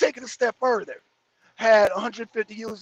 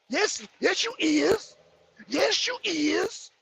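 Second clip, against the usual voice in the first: higher, slow mock; 5 semitones higher, 3 dB quieter, slower than usual. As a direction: drawl it teasingly. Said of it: "respect for the goddamn language".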